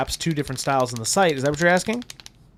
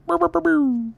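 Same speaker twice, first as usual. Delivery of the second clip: high sound effect. Pretend.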